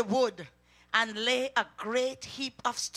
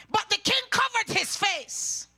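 preacher's rising cry; fiery preaching; 9 semitones higher, 3 dB louder than usual. Acted. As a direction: thunder the verse out to the church. Preaching the king's lament.

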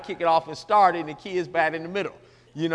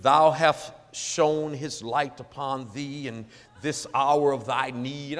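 slow, drawn-out mock-holy intoning; pompous preacher prayer; 5 semitones lower, slower than usual. Mocking preachers who pray in old English, pompous. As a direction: intone it low and ponderous, stretching every word. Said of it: "when preachers get up to".

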